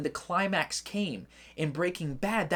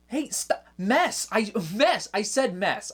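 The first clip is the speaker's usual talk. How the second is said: high, louder insistence on the word; protesting the bleep; 4 semitones higher, 3 dB louder, slower than usual. Real, not acted. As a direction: object urgently that the word was innocent.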